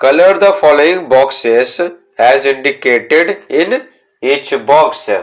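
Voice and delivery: very slow, word by word instruction read; slow textbook reading. Shown as a demonstration. Teacher reading the book's instruction to students, very slow. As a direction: spell out the instruction slowly for pupils.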